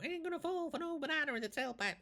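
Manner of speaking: high put-on movie-cop voice; playful impression of a Beverly Hills Cop line; Beverly Hills Cop character. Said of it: "Sounded like fucking him out of, a Beverly Hills Cop".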